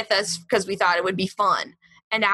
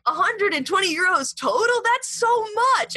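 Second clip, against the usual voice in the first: high, incredulous retold protest; shocked disbelief; 9 semitones higher than usual. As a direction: climb in pitch, aghast at the amount.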